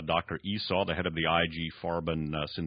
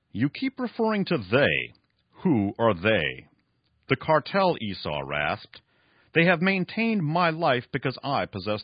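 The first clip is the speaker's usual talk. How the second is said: raised, emphatic story reading; dramatic novel reading; 5 semitones higher, 5 dB louder than usual. Acted. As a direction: read the villain's boast with drama. Reads novel passage aloud.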